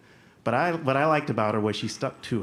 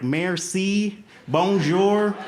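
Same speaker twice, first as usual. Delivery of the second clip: loud, high Southern-twanged French; hammed-up American Southern French; Southern-accented French; 8 semitones higher, 6 dB louder, much slower than usual. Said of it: "trigger my Southern accent".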